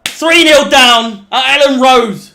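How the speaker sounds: football fan crowing he called the sending off; gloating victory shout. Real, not acted. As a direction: roar it like you predicted the red card. Fan's prediction of red card comes true.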